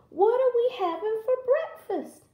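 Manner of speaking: very high-pitched granny voice; keen storybook grandmother; eager Granny. Acted. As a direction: ask with piping excitement. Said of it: "said Granny".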